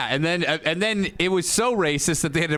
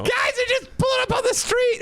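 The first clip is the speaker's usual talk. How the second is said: high mock yell; mock shout. Comic retelling a street promo, hammed-up high shout.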